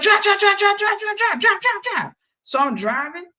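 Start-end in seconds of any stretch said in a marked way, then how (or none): 0.0-2.1 much higher, louder mock panic; frantic getaway plea. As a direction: imitate a passenger frantically urging the driver on.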